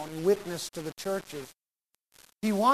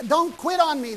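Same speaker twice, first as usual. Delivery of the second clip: higher, loud coaching yell; 8 semitones higher, 5 dB louder than usual. Retold or imitated. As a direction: yell to keep him going. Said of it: "the coach says".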